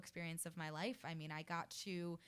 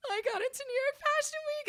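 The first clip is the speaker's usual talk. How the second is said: very high, loud tearful squeal; retold sobbing; 10 dB louder than usual. Telling little sister the news, imitated sobbing wail. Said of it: "And I start sobbing again".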